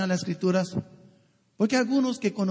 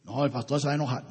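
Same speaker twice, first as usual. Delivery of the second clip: low, quick, hushed mock churchgoer; timid congregant; 8 semitones lower, 4 dB quieter, faster than usual. Pastor mimics a congregant afraid of his anger, low and quick. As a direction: mutter it like a nervous member dodging the pastor. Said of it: "porque saben que".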